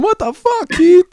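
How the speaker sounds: high, outraged yell at a friend. Retold or imitated. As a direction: yell at Keith from on top of the table. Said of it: "yelling at him".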